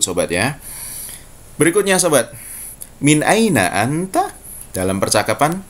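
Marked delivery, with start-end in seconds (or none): 3.0-4.3 slow Fusha "min aina anta" with a rise; formal Arabic. Shown as a demonstration. Teacher modelling standard Arabic for students. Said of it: "yang fusha".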